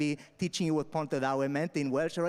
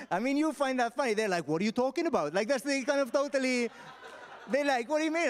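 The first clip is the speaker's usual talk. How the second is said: amused mock disbelief; 9 semitones higher than usual. Real, not acted. Mocking audience's reaction.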